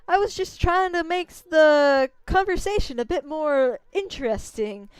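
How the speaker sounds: a brash tiny braggart; Issun voice. Acted.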